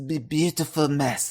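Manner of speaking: lightly done sultry-smoker impression; a hint of an invisible cigarette in the voice; put-on character voice. Co-host asks him to do the voice, lightly put-on character voice. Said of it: "Do the voice".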